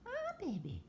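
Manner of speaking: high sing-song coo for the parrot. Real, not acted. Owner cooing at her parrot Jasper, high sweet baby talk.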